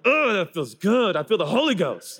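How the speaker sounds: high, savouring sigh of satisfaction; mock spiritual relish. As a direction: revel in it as if blessed. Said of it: "And I'm like".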